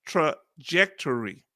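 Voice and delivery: trajectory slowly as "truh-JEK-tuh-ree"; slow, separated syllables on trajectory; careful pronunciation. Shown as a demonstration. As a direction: recite trajectory like a pupil, syllable by syllable. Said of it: "Thank you, English teacher".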